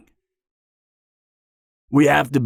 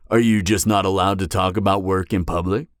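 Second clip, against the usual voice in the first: ordinary talk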